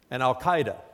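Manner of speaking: usual speaking voice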